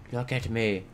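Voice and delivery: soft, controlled order; quiet command. Pretend. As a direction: drop to a hushed, stern demand. Drops to stern quiet order.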